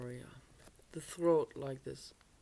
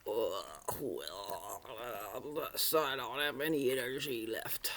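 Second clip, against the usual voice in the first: much lower, breathless, whispery toad sigh; sleepy toad; 6 semitones lower than usual. Acted. Hibernating toad sighing it has no energy, low whispery. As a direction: sound like a toad too cold to move. Sluggish toad voiced before winter.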